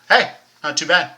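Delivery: usual speaking voice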